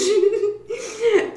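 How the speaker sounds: high burst of laughter. Real, not acted. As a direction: crack up out loud. Laugh after the jeer.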